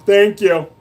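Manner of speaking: ordinary talk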